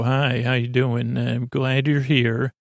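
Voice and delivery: usual speaking voice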